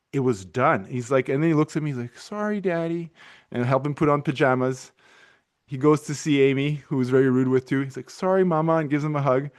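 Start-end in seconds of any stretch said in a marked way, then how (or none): 2.2-3.1 put-on small child apology. Son apologizes, quoted. 8.1-8.8 put-on small child apology. Son apologizes to mother.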